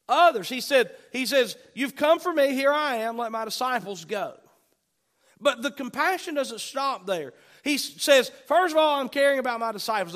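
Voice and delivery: fired-up preacher; impassioned preaching. Real, not acted. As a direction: drive the quoted commands home forcefully. Preaches at full force.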